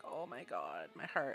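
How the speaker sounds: imitating being moved almost to tears; touched and moved. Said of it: "And I'm like".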